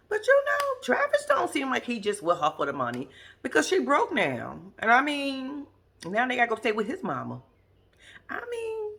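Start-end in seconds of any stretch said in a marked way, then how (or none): none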